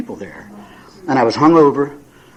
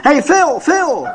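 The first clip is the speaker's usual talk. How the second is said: high calling "Hey, Phil, Phil!" across a parking lot; hollering; 13 semitones higher, much slower than usual. Retold or imitated. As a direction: call out to him from far across the lot. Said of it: "comes running across the lot hollering".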